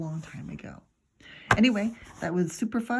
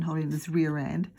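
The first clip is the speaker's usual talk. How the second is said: plain reading voice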